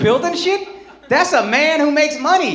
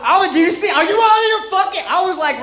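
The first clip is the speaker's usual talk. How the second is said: ordinary talk, nothing marked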